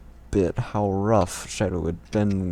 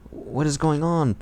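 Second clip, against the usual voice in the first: confused question; 7 semitones higher than usual. Acted. Character asks confused.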